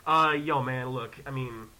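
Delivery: low, soft man-to-man talk; dad-like reasoning. Retold or imitated. Visitor coaxing the actor like a dad, calm reasoning. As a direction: take him aside and reason calmly. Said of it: "like I'm his fucking dad".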